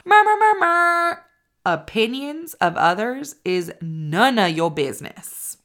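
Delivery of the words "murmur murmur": high, loud air horn blast with the voice; mock air horn. Shown as a demonstration. Podcast host imitating an air horn for listeners, loud and high. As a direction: blare it like a party air horn. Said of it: "I'm gonna do the air horn".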